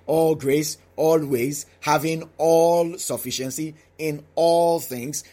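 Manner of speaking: each "all" held long and punched; slow down and lean on "all" every time it comes; stress on ALL. Shown as a demonstration. Preacher picking out the repeated word, stress on ALL. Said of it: "the emphasis on the word all".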